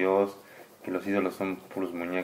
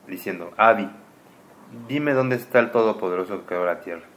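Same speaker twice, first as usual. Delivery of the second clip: lectura solemne; 10 dB louder than usual. Acted. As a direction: read it like sacred text to a child. Reads scripture aloud. (lectura solemne en voz alta, más fuerte y pausada)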